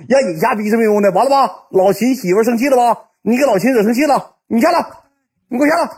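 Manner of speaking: high-pitched angry nagging; mock scolding. Attacker ordering friend off the wall, high mock scolding.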